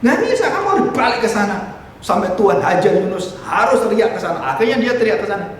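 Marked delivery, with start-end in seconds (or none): none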